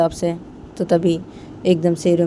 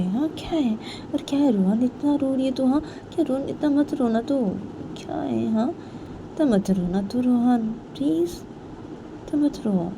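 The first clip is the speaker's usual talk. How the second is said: higher, softening pleas to stop crying; pleading; 7 semitones higher, 3 dB quieter than usual. Acted. Payal begs crying Rohan to stop, pleading. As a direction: plead gently in a raised voice, drawing out the last words.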